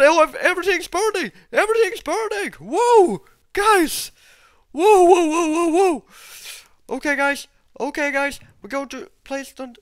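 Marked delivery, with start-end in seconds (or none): 0.0-6.0 high, loud panicky cries; alarmed excitement. Real, not acted. Gamer seeing everything burn, loud high alarm. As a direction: yell out in astonished alarm. Everything catches fire in the game.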